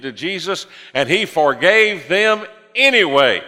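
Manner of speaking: loud, high, driving pulpit preaching; full-force preaching. Acted. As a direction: preach it out with rising fire.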